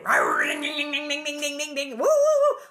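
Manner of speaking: loud roar then dinging and high whoops; playful train sound effects; train noise imitation. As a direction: make the train pulling in. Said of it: "It's like".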